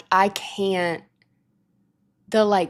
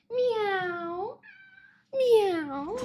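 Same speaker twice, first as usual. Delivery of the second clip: meowing at cat; 12 semitones higher, 5 dB quieter than usual. Pretend.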